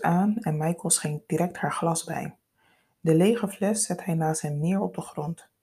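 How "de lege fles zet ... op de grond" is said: ordinary delivery, nothing marked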